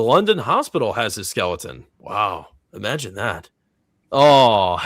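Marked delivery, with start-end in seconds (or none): none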